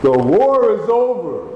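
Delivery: high proclaiming cry. Shown as a demonstration. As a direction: call it out to the whole room. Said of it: "let him cry it out to you".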